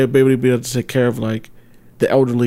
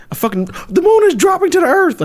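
excited. Real, not acted. Describing wild galactic dreams.

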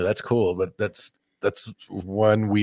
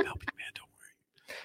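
barely voiced, very soft whisper; whispered aside; 17 dB quieter than usual. Real, not acted. Host reassures the man he is helping, whispered aside. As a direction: whisper it under your breath.

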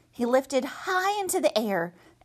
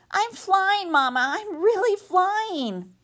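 high, slow joyful cry; excited little bird; 9 semitones higher, 3 dB louder, slower than usual. Acted. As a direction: call out in wonder. Gosling finally flies.

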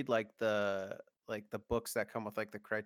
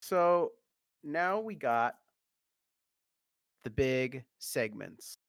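podcast announcer; 5 dB louder, slower than usual. Acted. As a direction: announce it like a show host. Host introduces sponsored segment.

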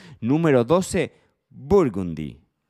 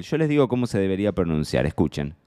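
usual speaking voice

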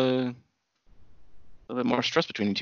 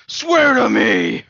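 slow, drawn-out mock demand, louder and higher; hammed-up demand; 9 semitones higher, 9 dB louder, much slower than usual. Friend play-acting the call, a slow hammed-up demand. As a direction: stretch it out like a dramatic interrogator. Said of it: "put us in the voice clip".

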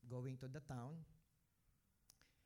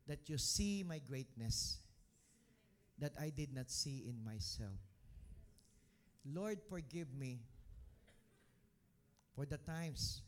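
fervent prayer; 5 dB louder than usual. Acted. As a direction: lead the room in heartfelt prayer, building. Leads congregation in prayer.

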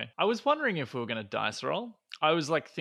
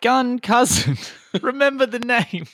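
high, loud crowing with a laugh; triumphant gloating; 13 semitones higher, 8 dB louder, slower than usual. Acted. Winner turns to Heidel to gloat, high loud crowing.

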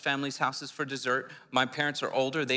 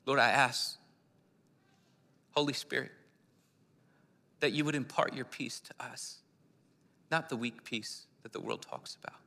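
prayer; 5 dB quieter than usual. Real, not acted. Pastor praying peace over the congregation, softly. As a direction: pray gently over them.

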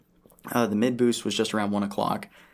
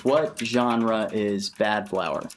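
slow dictation; much slower than usual. Real, not acted. Asks voice assistant a question.

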